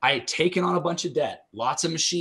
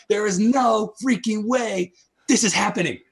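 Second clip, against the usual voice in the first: high, louder protest at fate; exasperated outcry; 9 semitones higher, 5 dB louder than usual. Retold or imitated. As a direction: push back hard against what's happening. Retold outburst of disbelief.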